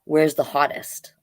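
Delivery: plain reading voice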